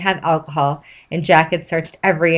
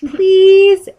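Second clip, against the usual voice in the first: high, louder begging please; louder pleading; 12 semitones higher, 4 dB louder than usual. A narrator's reading. SpongeBob begs Jack again, high whining plea. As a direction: beg harder, whining.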